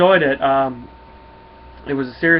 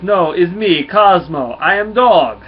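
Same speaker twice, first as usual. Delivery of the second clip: loud, higher-pitched Russian telepathic dog; Russian accent; 5 semitones higher, 11 dB louder, slower than usual. Acted. Cosmo the dog introduces himself to Nova, hammy Russian accent. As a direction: announce yourself proudly in a hammy Russian accent. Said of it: "always wanna do a Russian accent".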